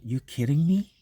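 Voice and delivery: mock disbelief, rising at the end; incredulous. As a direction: play it as a half-laughing you kidding me. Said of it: "It's like today we're saying".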